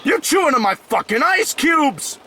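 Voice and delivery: high joking shout; playful yell. Real, not acted. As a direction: yell it in mock outrage. Playfully yelling about ice cubes.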